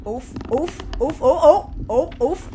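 ordinary delivery

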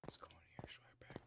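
real whisper, barely breathed. Real, not acted. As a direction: hush it so the monster won't hear. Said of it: "why am I whispering?".

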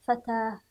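فتاة ending in h, no t; final ha sounded. Shown as a demonstration. Teacher repeats the ha reading, فتاة with final h. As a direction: drop the t of فتاة for an h. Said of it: "أو هاء".

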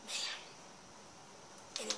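breathy whispered aside. Real, not acted. Player muttering to himself, whispered. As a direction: drop to a whisper.

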